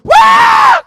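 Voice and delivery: shrieking demonic scream. Acted. Demon screaming as it leaves the man. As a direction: let out a piercing shriek. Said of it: "gritando al demonio".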